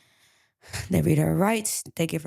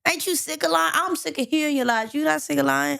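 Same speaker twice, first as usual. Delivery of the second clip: high-pitched exasperated scolding; fed up; 8 semitones higher than usual. Acted. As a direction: confront a suspect, voice pitched up in frustration. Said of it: "He's sick of his lies".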